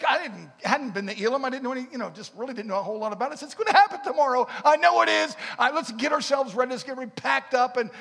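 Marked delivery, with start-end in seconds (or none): 3.5-7.9 much louder, higher, fast; excited urging. Retold or imitated. Husband urging his pregnant wife to pack, loud and excited. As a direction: rush her to pack up now.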